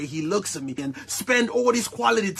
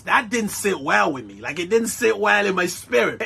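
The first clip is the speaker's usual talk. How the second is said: usual speaking voice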